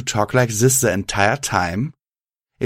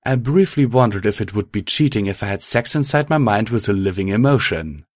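plain reading voice

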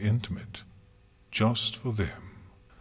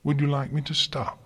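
dry, higher-pitched question; wry; 7 semitones higher than usual. A narrator's reading. Twilight asks Celestia whether to stop, wry. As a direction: ask with a wry lift. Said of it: "asked in a wry voice".

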